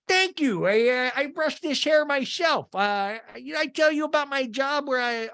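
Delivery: high, stretched-out goofy voice; Seth. Retold or imitated. Seth thanks men for hair compliment, high goofy boasting. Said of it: "Seth talking to two guys who compliment his hair".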